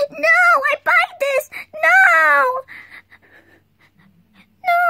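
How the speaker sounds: crying shout. Pretend. Child refuses robber.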